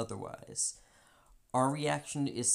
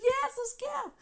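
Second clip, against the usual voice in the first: much higher, hyped; mock eager; 5 dB louder than usual. Hyping herself up against the friend, high. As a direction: egg yourself on for a fight.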